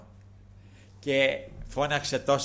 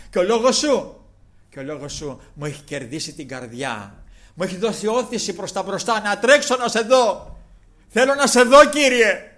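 building to a much higher, much louder cry; impassioned plea; 6 semitones higher, 11 dB louder than usual. Retold or imitated. Blind man tells Jesus he longs to see him, climbing passionate plea. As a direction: pour your whole heart into it.

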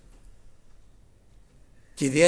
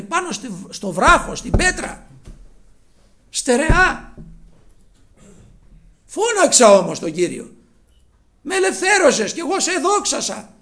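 high, loud exultant psalm; fervent preaching; 9 semitones higher, 8 dB louder than usual. Real, not acted. Preacher crying out David's psalm, high and loud. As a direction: proclaim it with full fervour.

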